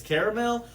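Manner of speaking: caramel as "CARE-a-mel"; own pronunciation, three syllables. Shown as a demonstration. Giving their own way of saying it, "CARE-a-mel". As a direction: say caramel with the middle A. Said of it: "how I pronounced it".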